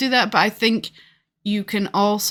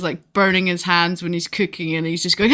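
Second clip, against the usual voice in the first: ordinary delivery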